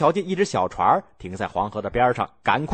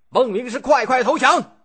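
high, loud battle cry; loud shout; 12 semitones higher, 5 dB louder than usual. A narrator's reading. Young general shouts at the trapped enemy, loud and high. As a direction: yell across the field for surrender. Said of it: "大声叫喊着".

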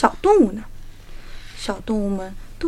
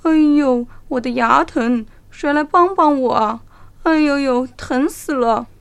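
howling with toothache; tiger in pain; 3 semitones higher, 7 dB louder than usual. A narrator's reading. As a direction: cry out in pain, dragging the end. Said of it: "老虎捂着嘴巴叫了起来".

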